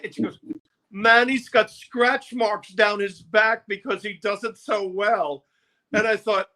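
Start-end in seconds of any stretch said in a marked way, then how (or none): 0.9-5.4 slow, higher, relishing camp voice; campy promoter. Retold or imitated. Promo man feeding the press lies, slow and higher. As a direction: savour the made-up gossip slowly.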